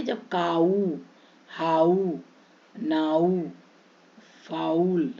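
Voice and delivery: drawn aa-oo in काऊ, हाऊ, नाऊ, फाउल; "aau" diphthong. Shown as a demonstration. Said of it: "अब आऊ में कब पढ़ेंगे?".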